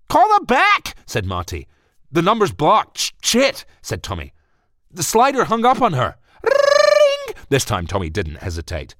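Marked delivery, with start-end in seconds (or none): 0.1-0.9 very high, loud frantic order; panicked shout. Acted. Marty panicking. 2.1-6.2 high, loud flustered panic; panicked. Acted. Tommy panicking. 6.4-7.4 very high, loud ringing phone; phone ring imitation.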